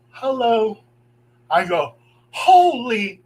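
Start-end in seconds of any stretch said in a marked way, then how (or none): 0.1-0.8 lightly voiced child's hello; a hint of a little girl. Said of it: "the little girl's voice said". 2.3-3.2 shocked exclamation. Retold or imitated. Shocked outburst cut short.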